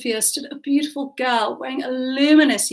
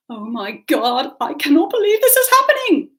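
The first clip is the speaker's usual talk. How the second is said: rising to a high, loud wail; yelping distress; 6 semitones higher, 6 dB louder than usual. Acted. Girl crouched on the terrace, yelping in distress. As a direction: exclaim in dismay, climbing sharply in pitch. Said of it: "the girl suddenly yelped".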